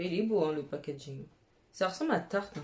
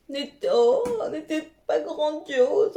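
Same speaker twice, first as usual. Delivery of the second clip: excited gushing; 7 semitones higher, 6 dB louder than usual. Real, not acted. Showing off her chain.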